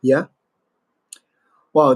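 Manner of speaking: usual speaking voice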